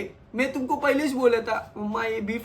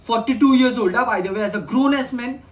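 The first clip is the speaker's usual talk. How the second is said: louder, slow, stressed grown ass man; outraged stress; 5 dB louder, much slower than usual. Real, not acted. Streamer outraged a grown man says this, slow and stressed. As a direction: hammer it home. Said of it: "outraged at a grown man".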